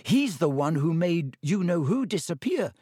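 unsure, pleading a little; young girl voice. A narrator's reading. Hannah doubting, girl voice.

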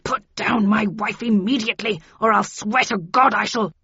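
loud, blustering husband's threat; outraged character voice, breaking off; angry. A narrator's reading. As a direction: order a rival away from your wife. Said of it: "in anger".

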